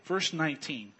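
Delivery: ordinary talk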